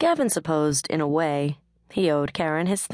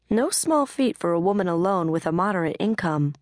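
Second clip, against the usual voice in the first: ordinary delivery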